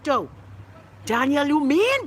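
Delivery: usual speaking voice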